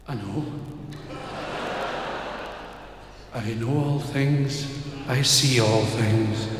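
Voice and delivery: low, slow, all-knowing; calm voice of God. Acted. God calmly telling the man he knows all. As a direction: intone it serenely.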